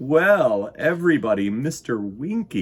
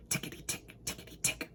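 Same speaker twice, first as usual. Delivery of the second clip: soft whispered ticking; whispering clocks; 14 dB quieter, faster than usual. Acted. Little clocks whispering their ticks. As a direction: breathe the ticks out lightly. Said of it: "whispered the little clocks".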